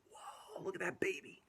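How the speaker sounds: hushed whispered amazement. Real, not acted. Whispered aside at picture.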